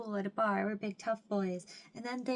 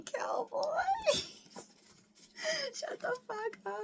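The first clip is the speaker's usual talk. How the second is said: much higher, near-tearful squeal; overwhelmed half-crying squeal. Real, not acted. Fan sees cowboy photo card, crying squeal. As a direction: break into fangirl crying.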